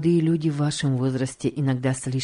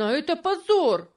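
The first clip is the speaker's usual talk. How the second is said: even higher, more desperate cry; the outrage breaks higher on the repeat; crying out, holding back sobs, rising; 13 semitones higher than usual. A narrator's reading. George repeats his outcry, higher and closer to tears. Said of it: "кричал он со сдержанным рыданием".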